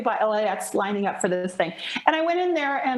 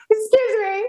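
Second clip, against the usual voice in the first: higher, louder "Excuse me"; indignant excuse me; 8 semitones higher, 5 dB louder than usual. Retold or imitated. Replaying the protest at the weigh-in, higher and louder. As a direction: push it up, affronted. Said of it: "with all this talk".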